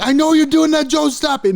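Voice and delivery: high-pitched mock yelling at the uncle; loud, very high accusation; panicked protest. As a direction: imitate frightened boys shouting him down.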